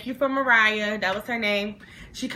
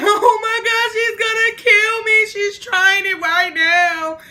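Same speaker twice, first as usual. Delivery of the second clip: loud, very high wailing; hammed-up crying fit, dragged out at the end; mock tearful panic; 11 semitones higher, 8 dB louder, slower than usual. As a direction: wail it, acting out terror for your life. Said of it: "I would bust out crying".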